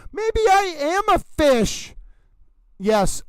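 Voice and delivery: high, slow, wondering; dreamy wonder. Acted. Streamer muses aloud to chat, high and slow. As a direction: muse it like a revelation.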